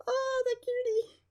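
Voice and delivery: cutesy squeal. Real, not acted. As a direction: coo it like at a puppy. Gushing over cute boots.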